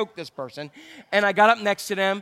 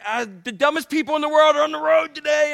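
higher and louder, exasperated rant; mock road rage; 6 semitones higher, 5 dB louder than usual. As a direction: vent at other drivers, half joking. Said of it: "I'm like".

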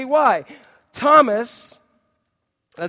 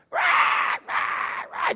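mock crying squawk, "rah"; imitated child's squawking; slower than usual. Preacher mimicking children who never stop crying, mock squawk. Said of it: "If something bothers them".

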